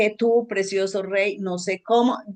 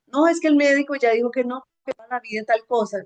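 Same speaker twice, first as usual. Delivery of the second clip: defeated complaint; 6 semitones higher, faster than usual. Retold or imitated. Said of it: "Y no puedo decir".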